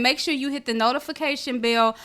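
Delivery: ordinary talk, nothing marked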